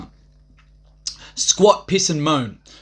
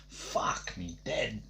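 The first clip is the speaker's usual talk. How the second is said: very slow, unvoiced exasperated whisper; whispered groan; 8 semitones lower, 16 dB quieter, much slower than usual. Real, not acted. Whispering in disbelief to himself. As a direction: breathe it out in disbelief.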